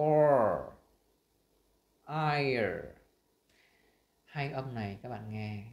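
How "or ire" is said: "or" and "ire" loud, drawn out into the r; R-colored vowel demo. Shown as a demonstration. Teacher sounding out two similar R sounds, loud drawn-out demo. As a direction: model "or" and "ire" slowly and forcefully for learners. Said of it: "Việc phát âm tất cả những cái âm này".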